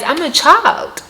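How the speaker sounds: normal talking voice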